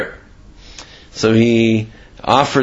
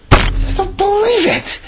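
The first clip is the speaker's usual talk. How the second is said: high, slow, stunned gasp of disbelief; the priest can't believe his eyes; shocked disbelief; slower than usual. Acted. Priest catching the convert, high and stunned.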